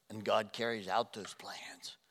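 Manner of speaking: slow whisper; hushed slow whisper. Acted. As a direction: whisper it slowly for weight. Whispered point in sermon.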